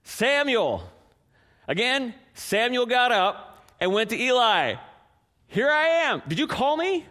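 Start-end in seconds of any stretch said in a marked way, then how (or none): 0.0-0.9 high, raised call of the name. A narrator's reading. The Lord calls the sleeping boy again, raised calling voice. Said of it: "Then the Lord called again". 5.5-7.0 high, raised eager answer; eager boy. Acted. Boy runs to Eli thinking he called, high eager voice.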